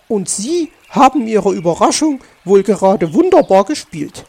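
loud, lively mock praise; amused teasing. A narrator's reading. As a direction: tease with a touch of amusement. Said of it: "lachte die Elster". (belustigt spottend, laut)

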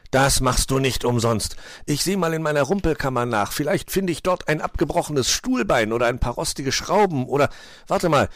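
hissed retort. A narrator's reading. Winner threatens useless gifts in return, heated retort. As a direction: fire back with mild spite. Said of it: "zischte er zurück".

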